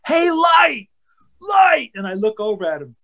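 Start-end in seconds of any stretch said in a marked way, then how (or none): 0.1-1.9 high, hollered name call; imitated screaming. Teammate yelling to the pitcher from the dugout, mock scream. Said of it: "and he's screaming".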